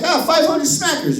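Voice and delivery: lightly voiced little seller; hint of a kid shopkeeper. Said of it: "He's like".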